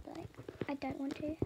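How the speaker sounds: soft breathy whisper. Real, not acted. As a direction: whisper it to yourself. Trailing aside to herself.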